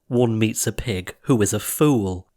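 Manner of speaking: plain reading voice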